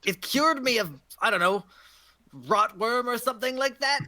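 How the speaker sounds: put-on old hag's testy insistence; indignant old hag. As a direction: explain yourself as an affronted crone. Game master voices old hag.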